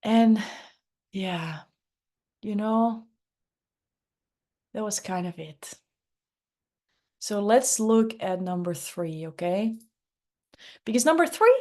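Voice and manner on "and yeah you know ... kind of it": unhurried, low-energy slacker voice; Lazy Larry voice. Shown as a demonstration. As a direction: let every word sag as if effort costs too much. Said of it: "he talks a little bit like this".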